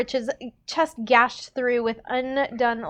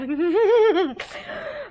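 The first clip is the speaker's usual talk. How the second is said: high giggle; high, light laugh; 8 semitones higher than usual. Real, not acted. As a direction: giggle at your own remark. Laughs after Cinderella remark.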